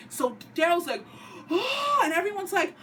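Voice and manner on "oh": high shocked oh; shocked gasp. Retold or imitated. Dale reacting to Beth's shooting, shocked high oh.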